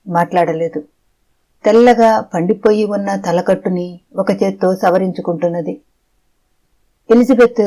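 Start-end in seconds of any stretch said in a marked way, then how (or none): none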